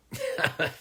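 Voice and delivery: soft voiceless laugh. Real, not acted. Comic after his four-states joke, soft laugh. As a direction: laugh at your own punchline.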